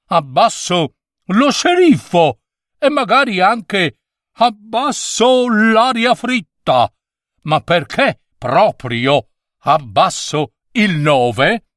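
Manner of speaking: voce acuta e risentita del numero nove; un piccolo numero permaloso che si lamenta; il nove offeso. A narrator's reading. Voice of the offended nine. (il nove rimprovera lo scolaro, voce alta e offesa)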